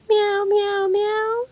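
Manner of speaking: high, drooping mews; sad kitten meows. Acted. Kittens sigh over dirty mittens, sad high meows. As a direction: mew sadly like kittens.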